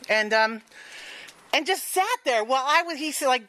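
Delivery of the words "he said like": ordinary talk, nothing marked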